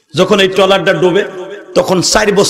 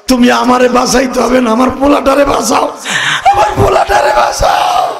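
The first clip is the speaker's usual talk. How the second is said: much higher, desperate wailing plea; mother screaming; 6 semitones higher than usual. Acted. Drowning mother screams to her husband to save their boy, high wail. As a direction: cry out as a drowning mother begging for her son. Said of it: "মা চিৎকার মেরে কয়".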